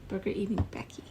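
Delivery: hushed unvoiced whisper. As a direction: whisper it like a secret. Whispered retake of same line.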